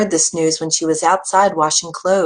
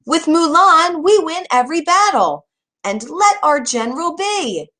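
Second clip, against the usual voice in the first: much higher and louder, defiant shout; loud calling out; 10 semitones higher than usual. A narrator's reading. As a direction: shout back in her defence. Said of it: "in voices just as loud".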